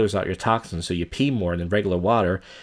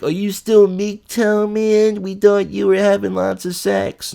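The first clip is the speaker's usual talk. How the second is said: high, loud, drawn-out mock voice; mocking viewer voice; 11 semitones higher, 6 dB louder, slower than usual. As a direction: imitate teasing fans, slow and high-pitched. Voicing imagined viewers teasing him.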